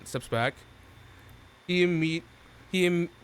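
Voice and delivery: ordinary delivery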